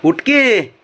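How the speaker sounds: loud, high, insistent urging; insistent call. Real, not acted. Owner telling a sulking pet to get up, loud insistent call. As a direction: raise the voice high and urge.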